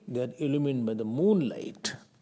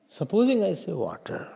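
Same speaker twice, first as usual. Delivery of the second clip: normal talking voice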